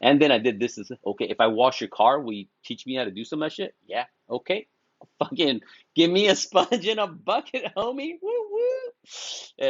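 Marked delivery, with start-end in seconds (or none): 5.2-9.7 high-pitched, playful eager helper; eager hype. Acted. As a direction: play it eager, rising into a high falsetto whoop. Playfully acts out eager car washing.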